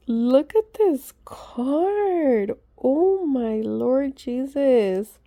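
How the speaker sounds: slow, high, breathless wonder; gasping over the card's beauty; awed gasp. Real, not acted. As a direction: stretch it out in amazement.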